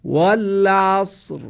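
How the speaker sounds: والعصر ending in a thick, heavy ر, slowly recited; heavy Quranic r. Shown as a demonstration. Quran teacher models the word for the class, slow and heavy. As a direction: draw out والعصر with a full heavy ر. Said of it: "باید دقت کنید که را تفخیم بشه".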